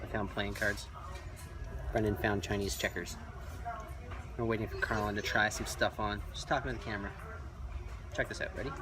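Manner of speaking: quiet in-store vlogging voice; hushed aside. Real, not acted. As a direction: keep it down, discreetly, for the camera. Talking to camera inside a shop.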